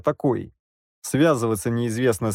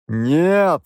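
a louder, hoarse refusal; raised voice; 5 semitones higher, 5 dB louder than usual. A narrator's reading. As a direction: raise your voice and rasp. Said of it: "повысил голос я".

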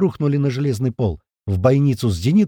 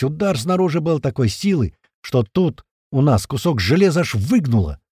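plain reading voice

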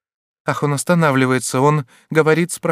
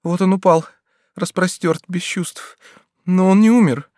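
plain reading voice

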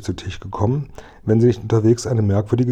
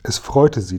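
ordinary delivery, nothing marked

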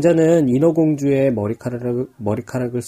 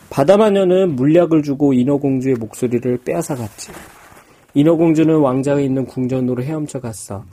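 ordinary delivery, nothing marked

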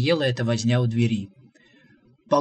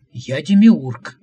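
slow, much louder proclamation; grand declaration; 10 dB louder, much slower than usual. A narrator's reading. Visitor proclaiming to the prophet that he is the demiurge, booming. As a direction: announce yourself like a god.

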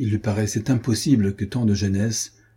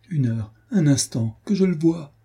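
pleading; 6 semitones higher than usual. A narrator's reading. Adrienne begs the guard.

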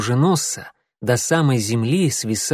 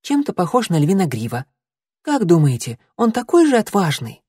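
kitten voice; 7 semitones higher than usual. A narrator's reading.

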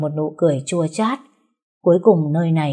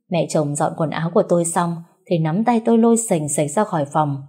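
usual speaking voice

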